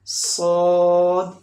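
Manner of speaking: slow, loud, thick "सौद"; heavy fat saad. Shown as a demonstration. Teacher drills the heavy letter for students, slow and loud. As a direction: hold "सौद" full-mouthed and heavy. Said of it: "सौद fat sound letter भी है".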